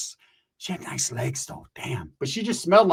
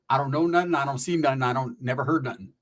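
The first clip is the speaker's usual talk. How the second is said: ordinary talk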